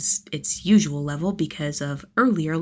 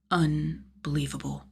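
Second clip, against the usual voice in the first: plain reading voice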